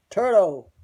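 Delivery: loud, sudden shout of a name; re-enacted holler, falling at the end; retold heckle. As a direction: blurt the name out as a loud jeer.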